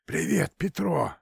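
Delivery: high hoarse hissing voice; raspy whisper. Acted. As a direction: hiss it down the phone. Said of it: "прошипело в трубке".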